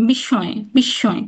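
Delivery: slow বিস্ময় as "bish-shoy", slightly nasal; doubled and nasal. Shown as a demonstration. Teacher showing the rule, double nasal sh in বিস্ময়. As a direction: hold the sh in বিস্ময়, no m. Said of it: "কিঞ্চিত নাসিক্য স্বর নিহিত থাকে".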